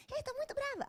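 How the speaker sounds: high, teasing voice of anger; anger personified. Pretend. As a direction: play anger as a taunting imp. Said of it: "Aí ela vem". (a raiva provocando, voz aguda)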